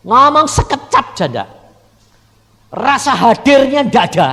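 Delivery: loud, high preacher's cry; preaching. Acted. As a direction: proclaim it to the congregation. Preaching at full force. (khotbah berapi-api dengan suara keras)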